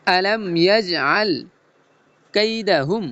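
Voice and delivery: slow chanted phrases with breaks between; chopped-phrase recitation. Shown as a demonstration. Teacher modelling the verse phrase by phrase, slow chopped recitation. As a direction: recite it in cut pieces, drawing out the long vowels. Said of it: "কাটা কাটা সুরে বলবো".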